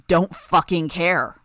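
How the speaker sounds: loud, slow snarl; angry growl. A narrator's reading. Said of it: "Hookwolf growled".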